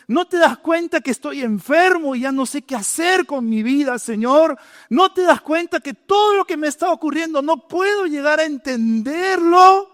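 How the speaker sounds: pleading complaint. Acted. Voicing desperate disciples and believers.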